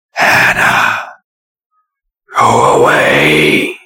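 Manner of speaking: slow, raspy ghostly whisper; frightening harsh whisper. A narrator's reading. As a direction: breathe it at her face like a menace. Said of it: "Its frightening, harsh whisper".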